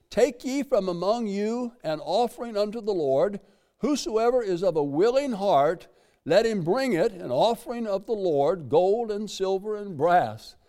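lifted pulpit reading of scripture. Acted. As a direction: read it out like holy writ. Reads scripture aloud in sermon.

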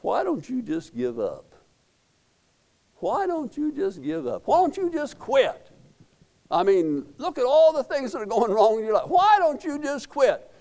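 tempter's voice building from hushed to high-pitched nagging; devil's whispered temptation. Acted. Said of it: "devil who's always whispering to us".

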